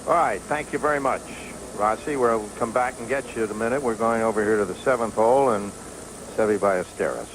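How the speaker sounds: ordinary talk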